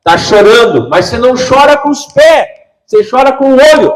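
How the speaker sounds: high, loud, almost laughing; fiery preaching. Real, not acted. Preacher shouting at the congregation, high and loud. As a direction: throw it out to the crowd, high and loud.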